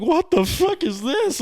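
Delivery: imitated crying shriek, very high; tearful outburst. Telling a friend about finding the bloody bathroom, tearful wail. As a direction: wail it in hammed-up tearful disbelief. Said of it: "started fucking crying more".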